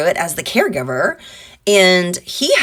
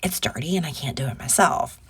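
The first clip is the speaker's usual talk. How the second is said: cat's voice; 3 semitones lower, 7 dB quieter than usual. Retold or imitated. The cat demanding a clean litter box, sassy whisper. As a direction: hiss it like a bossy cat. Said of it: "she'd have been like".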